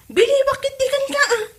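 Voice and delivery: sudden high-pitched challenge; surprised high question. Acted. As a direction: snap the question up high in disbelief. Wife questions husband's brother-in-law.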